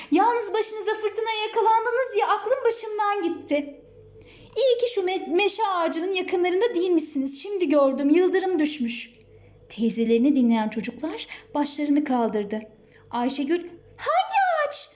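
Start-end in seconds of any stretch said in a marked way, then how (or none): 0.1-9.1 lightly voiced relieved, fretting aunt; a touch of higher-pitched worry; worried aunt. Aunt finding children after the storm, lightly worried. 14.0-14.9 very high, alarmed question; trembling voice. A narrator's reading. Ayşegül hearing of lightning strike, trembling voice. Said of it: "derken sesi titriyordu".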